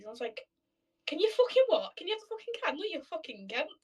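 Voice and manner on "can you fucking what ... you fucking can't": higher, mock-outraged comeback to the dad; retold indignant retort. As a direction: play up the indignation as you retell it. Retold telling off the neighbour's dad.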